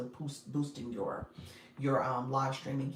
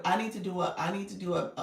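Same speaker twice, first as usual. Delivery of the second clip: ordinary talk, nothing marked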